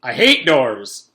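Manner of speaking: loud, slow angry shout. Acted. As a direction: shout it out in disgust. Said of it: "shouted Mister Kidswatter".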